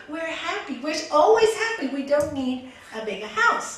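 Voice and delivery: high, loud pleading objection; storyteller's husband, insistently objecting; protesting husband. Acted. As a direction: protest with voice pushed up. Husband protests the new demand.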